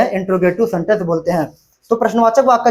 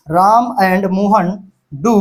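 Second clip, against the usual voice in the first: slow dictation; much slower than usual. Shown as a demonstration. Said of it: "slow dictation while writing".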